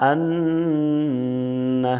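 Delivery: আন্না with a long held nasal n; nasal ghunnah. Shown as a demonstration. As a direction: hum the doubled n of আন্না through the nose. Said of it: "নাকের ভিতর থেকে বলতে হবে". (আন্না নাক থেকে গুন্নাহ করে টেনে)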